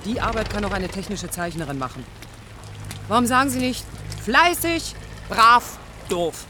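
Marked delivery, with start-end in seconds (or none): 3.1-6.4 higher and louder, spitting each word; sarcastic, rising to a loud peak; bitter outburst. Acted. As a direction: throw the labels back at him.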